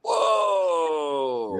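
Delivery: long, high falling whoa; amazed whoa. Real, not acted. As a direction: draw it out high, sliding down. Reacts to a very low price.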